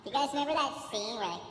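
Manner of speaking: usual speaking voice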